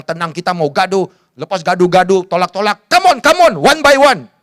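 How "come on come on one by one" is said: high, loud jeering dare; schoolyard taunt. Retold or imitated. Bully daring a classmate after school, loud jeering taunt. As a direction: call the other kid out, high and loud.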